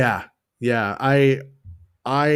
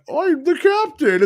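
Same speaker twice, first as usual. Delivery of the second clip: much higher, slow, pompous mock voice; mocking captain; 6 dB louder than usual. Mocking the hotel captain character, high pompous voice. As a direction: lampoon the blue-faced captain grandly. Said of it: "It's stupid when it's like".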